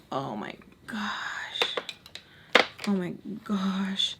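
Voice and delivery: slow, breathy dread; dread whisper. Retold or imitated. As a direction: whisper it in horror. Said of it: "And I'm like".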